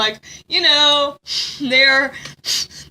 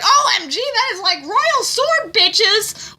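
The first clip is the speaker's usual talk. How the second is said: high, excited mock gush; starstruck guard; 7 semitones higher, slower than usual. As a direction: gush in a hammed-up higher voice. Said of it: "and the guard's like".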